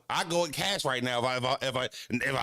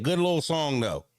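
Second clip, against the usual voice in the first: usual speaking voice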